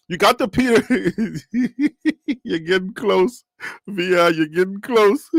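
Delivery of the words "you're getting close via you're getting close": drawn out through real laughter; slow, amused, with a laugh in it. Real, not acted. Host teasing viewers' guesses at the drink, laughing.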